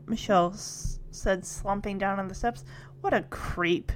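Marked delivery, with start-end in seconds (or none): 3.0-3.9 low, soft grumble; grumbling. A narrator's reading. Stephanie complains about Robin to her sister, grumbling low. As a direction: grumble it under the conversation, low. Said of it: "Stephanie grumbled".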